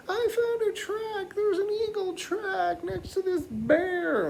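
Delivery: put-on very high, squealing excited-kid voice. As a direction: mimic thrilled children in a high voice. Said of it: "They're so excited".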